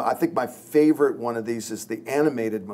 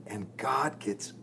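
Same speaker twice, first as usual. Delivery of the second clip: usual speaking voice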